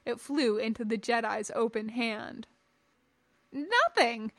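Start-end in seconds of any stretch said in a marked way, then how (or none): 3.5-4.3 mild squeak of fear, pitched up; terrified squeak. A narrator's reading. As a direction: blurt a frightened denial. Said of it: "the terrified humanoid squeaked".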